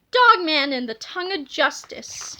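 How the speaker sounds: ordinary delivery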